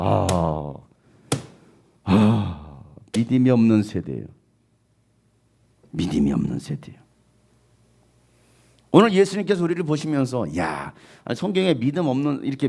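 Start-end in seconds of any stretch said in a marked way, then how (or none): 0.0-7.0 deep sighing lament, lower and fading. Acted. Preacher voicing Jesus lamenting the faithless generation, sighing. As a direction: groan it out like a weary sigh. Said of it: "한탄하시면서".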